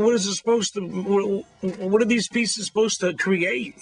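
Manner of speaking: high, baffled asking; puzzled question. Retold or imitated. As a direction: sound genuinely puzzled. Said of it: "I said".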